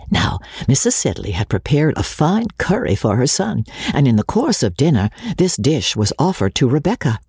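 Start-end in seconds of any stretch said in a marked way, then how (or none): none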